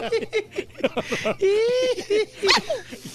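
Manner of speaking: big high-pitched laugh. Real, not acted. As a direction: burst out laughing. Bursting into laughter. (carcajada aguda)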